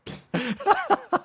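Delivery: very high-pitched laugh; real laugh. Real, not acted. Player laughing at their own bad roll, high-pitched laugh. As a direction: keep giggling helplessly, high up.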